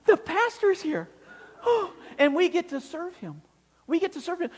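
joyful tears. Retold or imitated. Jim telling the kid, tearful with delight. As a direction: overcome with joy. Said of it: "out of his excitement".

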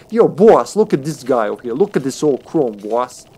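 put-on light 1920s Chicago mobster accent; a hint of a Chicago gangster calling to his boss. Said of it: "nineteen twenties Chicago mobster accent".

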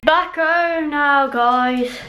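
high, loud, drawn-out call; sing-song announcement. Real, not acted. Vlogger telling viewers they are home, high sing-song.

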